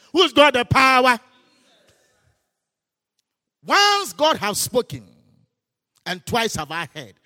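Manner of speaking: loud, high, repeated challenge; preaching at full force. Acted. Pastor challenging the congregation about power, loud fiery preaching. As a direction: thunder the question at the crowd.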